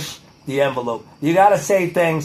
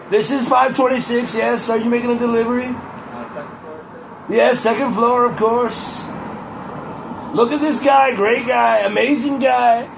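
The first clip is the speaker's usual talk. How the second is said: Donald Trump impression; 6 semitones higher than usual. Greeting the delivery man in a Trump voice, blustering praise. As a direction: gush over the delivery guy like a politician. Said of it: "Who am I impersonating ... Donald Trump".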